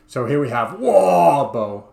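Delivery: lightly put-on British orc accent, slow and loud. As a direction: ham up a British orc, slowly. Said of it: "a terrible British accent".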